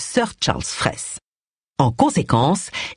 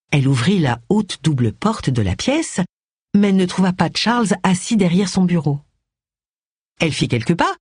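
normal talking voice

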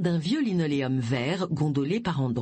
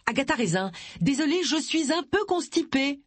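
raised, high-pitched call through a door; high apologetic call; 8 semitones higher than usual. A narrator's reading. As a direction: call back with an embarrassed excuse. Calling through a closed toilet door.

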